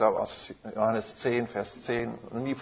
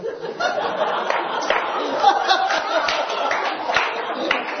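loud high airy laugh, falling; high laughing burst; 4 dB louder than usual. Real, not acted. Preacher laughing at telling the church it sang enough, laughing burst. Laughing at his own announcement.

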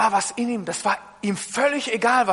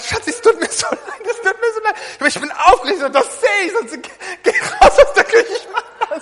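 much higher, apologising through laughter; laughing apology; 11 semitones higher, 5 dB louder than usual. Retold or imitated. Husband apologising to his wife, laughing. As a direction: say sorry while giggling. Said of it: "Ich hab gesagt".

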